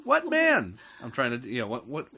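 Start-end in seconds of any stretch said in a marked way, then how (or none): 0.0-0.8 much higher, louder, sharp mom voice; squeaky alarmed mother. Retold or imitated. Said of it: "trying to find my mom voice".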